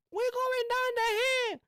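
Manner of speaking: high falsetto announcement; idiot friend. Retold or imitated. Idiot friend giving away their spot in a high falsetto.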